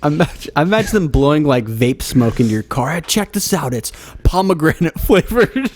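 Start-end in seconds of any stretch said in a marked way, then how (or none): 2.9-5.7 bro barista voice. Retold or imitated.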